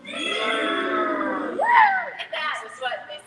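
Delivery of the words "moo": high, loud mooing. Shown as a demonstration. Teacher mooing high for the kids. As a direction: moo up high like a cow. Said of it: "And what do they say?".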